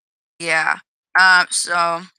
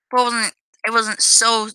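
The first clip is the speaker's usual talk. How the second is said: usual speaking voice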